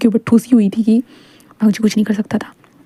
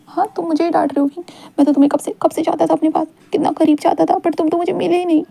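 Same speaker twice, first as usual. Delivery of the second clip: 1 whiny high complaint; 7 semitones higher than usual. Acted. Wang complaining lovingly.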